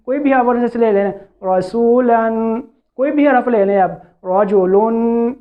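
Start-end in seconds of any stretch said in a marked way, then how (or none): 1.5-2.6 slow رسولن ending on a clear n; audible final noon. Shown as a demonstration. Teacher giving another tanween example, audible final noon. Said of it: "یہ آخر میں نون ساکن کی آواز آ رہی ہے". 4.3-5.3 slow رجلن ending on a clear n; audible final noon. Shown as a demonstration. Teacher giving another tanween example, audible final noon. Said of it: "یہ آخر میں نون ساکن کی آواز آ رہی ہے".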